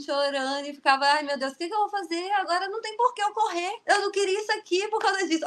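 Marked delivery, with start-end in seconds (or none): 1.0-5.4 high, fast imitated sobbing lament; retold tearful phone call, high and rushed; mock crying. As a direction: whine it like a despairing child. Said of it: "eu liguei chorando".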